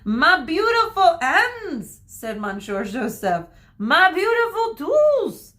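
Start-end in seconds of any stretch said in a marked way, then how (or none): 0.0-2.0 high, loud, drawn-out lament; anguished wail. A narrator's reading. Hairdresser mourns his bitten hands in a high wail. 3.8-5.5 much higher, loud theatrical lament; anguished wail. A narrator's reading. Hairdresser mourns his fallen scissors in a high wail.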